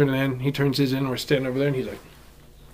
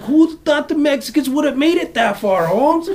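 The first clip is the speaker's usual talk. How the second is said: higher, slow voiced buddy at the DMV, a bit cartoonish; mock homie drawl, higher pitched; 11 semitones higher, 4 dB louder, slower than usual. Guy at DMV voiced in story.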